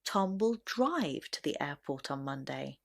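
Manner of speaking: strong emphasis on drive, then fall away; stress on DRIVE. Shown as a demonstration. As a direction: hit the word drive. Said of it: "stress will naturally be on the word drive".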